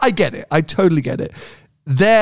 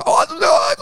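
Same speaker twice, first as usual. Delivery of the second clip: very high gabbled mock yelling; pitched-way-up impression of a yelling woman; yelling Dutch woman. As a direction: imitate an angry foreigner's shrill gibberish. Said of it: "starts coming up to my car and yelling".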